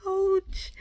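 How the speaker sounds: high, drawn-out ouch; pained ouch. Retold or imitated. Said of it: "that thing hurt like the other needle".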